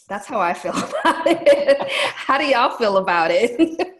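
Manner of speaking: laughing while asking. Real, not acted. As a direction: laugh through the words. Laughing after venting.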